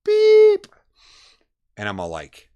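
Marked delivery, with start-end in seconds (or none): 0.0-0.7 loud, very high answering-machine beep; imitated beep. Answering machine cutting in on the call, imitated beep. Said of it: "I hear a beep".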